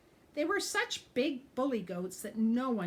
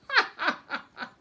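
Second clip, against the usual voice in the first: mocking laugh; 11 semitones higher, 10 dB louder than usual. A narrator's reading. Goat mocks the ogre.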